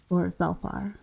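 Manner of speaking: ordinary talk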